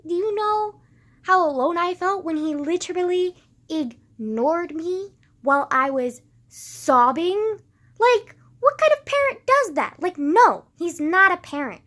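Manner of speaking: high, loud, cracking outburst; real anger. Real, not acted. Daughter venting about her father ignoring her, loud and furious. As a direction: let the hurt burst out in fury.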